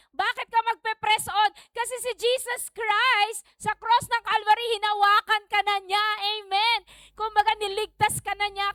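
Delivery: high-pitched, insistent sermon voice; fervent preaching. Real, not acted. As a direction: drive the reason home to the congregation.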